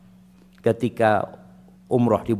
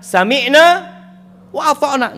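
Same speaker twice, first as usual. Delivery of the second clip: much higher and louder, proclaimed; proclaimed slogan; 13 semitones higher, 7 dB louder than usual. Acted. Preacher gives the believers' slogan to the congregation, proclaimed loud.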